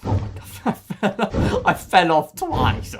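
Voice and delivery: slow words through laughter; laughing while talking. Real, not acted. Admitting he fell off twice, laughing through it. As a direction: giggle through the confession.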